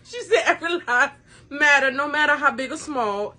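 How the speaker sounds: can barely plead for laughing. Real, not acted. Daughter defends her essay to her mother, laughing as she pleads.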